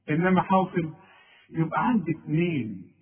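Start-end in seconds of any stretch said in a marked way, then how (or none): none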